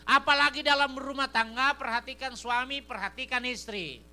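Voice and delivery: loud, high preaching voice. Acted. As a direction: preach it out to the church. Preacher at full force.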